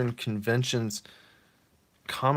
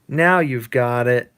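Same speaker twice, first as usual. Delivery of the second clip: louder, slow flirty wife voice; voicing the wife; 2 semitones higher, 9 dB louder, slower than usual. Wife answering her husband's toast, slow and flirty. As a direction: purr it like a pleased wife. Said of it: "And she says".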